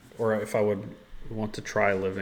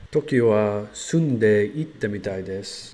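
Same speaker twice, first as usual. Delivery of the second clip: slow, loud learner Japanese; careful practice of a Japanese answer; japanese learner accent; 8 dB louder, slower than usual. Shown as a demonstration. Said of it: "Let's go ahead and try it".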